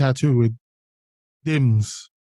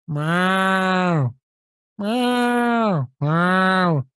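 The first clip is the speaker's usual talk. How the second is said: cat meowing; 3 semitones higher, much slower than usual. Acted. Cat calling from the wood to the old lady. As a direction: call out like a cat hidden in the woods.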